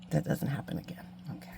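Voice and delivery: soft whispered afterthought. Real, not acted. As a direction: whisper it under your breath. Whispered aside while working.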